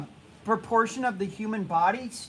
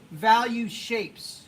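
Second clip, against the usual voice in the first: repeated slowly with weight on "Value"; steady slow teacher repeat of "Value shapes"; much slower than usual. Shown as a demonstration.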